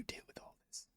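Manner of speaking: fast breathy whisper of the line. Shown as a demonstration. Player whispering the character's line before he says it. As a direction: hush it like a secret. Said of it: "I knew he was gonna say it like that".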